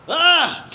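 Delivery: short, high, loud yelp; sudden mock-terrified scream; startled scream. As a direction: shriek as if the closet just opened. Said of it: "a lot of skeletons in his closet".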